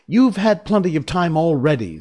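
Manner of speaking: lightly acted indignation; angry. A narrator's reading. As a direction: protest crossly, raising pitch and volume. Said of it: "said the Tin Woodman angrily".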